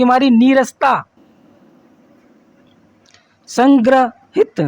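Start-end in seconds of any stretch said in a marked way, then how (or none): 3.5-4.6 संग्रहित read out slowly, syllable by syllable; slow, careful संग्रहित; slow wrong form. Shown as a demonstration. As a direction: hold up संग्रहित slowly as the mistake. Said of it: "गलत वर्तनी है ये".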